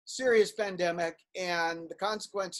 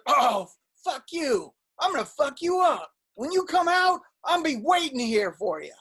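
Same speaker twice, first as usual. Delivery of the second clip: higher, louder imitation of a threat; mock threatening stranger; 7 semitones higher, 4 dB louder than usual. Retelling a homeless man threatening him outside the store, mock menace. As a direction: play the furious stranger, hammed-up.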